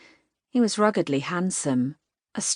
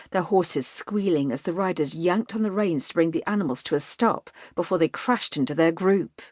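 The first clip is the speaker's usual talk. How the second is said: ordinary delivery, nothing marked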